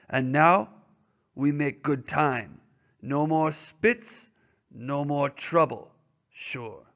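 lightly voiced, pleased French-Canadian driver; Francois relieved Spitz is gone, a hint of accent. Francois continues his speech.

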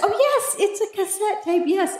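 high, light reply with "cassette" run off easily; mock flattered. Answering a stranger curious about the tape, playfully flattered. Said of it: "I was flattered there for a moment".

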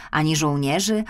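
ordinary delivery

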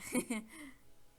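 high amused laugh; burst of laughter. Real, not acted. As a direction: laugh out at the funny look. Laughing at character's look.

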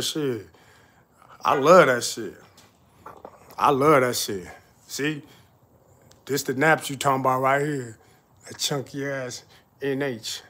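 usual speaking voice

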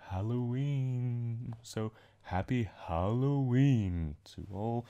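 very slow, drawn-out spooky voice; spooky greeting. Pretend. Vlogger wishes followers a happy Halloween, slow and spooky. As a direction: stretch it like a haunted-house host.

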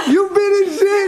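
loud laughing shout; laughing hard. Real, not acted. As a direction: crack up while shouting it. Host cracking up.

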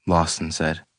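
plain reading voice

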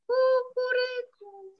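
very high crowing call; rooster crow. Acted. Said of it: "Źrebak na to".

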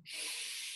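long breathy sigh out; deep audible exhale. Shown as a demonstration. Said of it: "Breathe in".

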